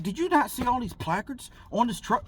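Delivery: high, loud scolding, imitated; incredulous cop. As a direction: play the officer aghast at her blindness.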